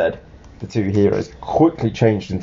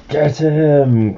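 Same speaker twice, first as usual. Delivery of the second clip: higher, drawn-out mock villain yell; storybook yell; 5 semitones higher, 5 dB louder than usual. A narrator's reading. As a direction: order the attack, playfully yelling. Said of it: "Grodd yelled".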